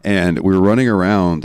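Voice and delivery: usual speaking voice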